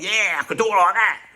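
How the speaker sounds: a touch of brash Cockney bravado; Cockney bloke. Retold or imitated. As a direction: swagger it like a Norman Wisdom Cockney. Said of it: "bit of Norman Wisdom Cockney thing".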